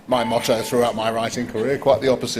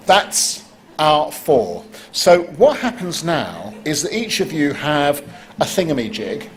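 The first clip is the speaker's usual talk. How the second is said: ordinary talk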